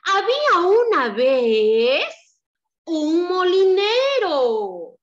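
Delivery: slow storybook opening, drawn out; story narration. A narrator's reading. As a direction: tell it like a fairy tale to small children.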